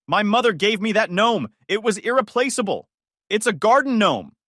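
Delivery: high, loud, wounded indignation; outraged. Acted. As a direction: defend the gnome furiously. Joyce about the gnome.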